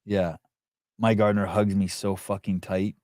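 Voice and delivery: normal talking voice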